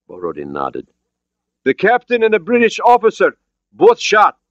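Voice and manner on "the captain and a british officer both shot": put-on high, loud accented report; accented shout. Borodin reporting casualties to the raft, accented shout. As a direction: call it down in a foreign accent. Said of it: "He had an accent, but his English was understandable".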